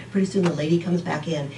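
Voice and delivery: ordinary talk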